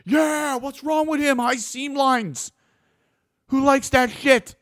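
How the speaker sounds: high, loud scoffing mimicry of a hater; mocking critic. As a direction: sneer it out like a whiny critic.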